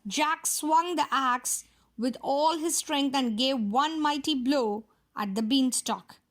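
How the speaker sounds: plain reading voice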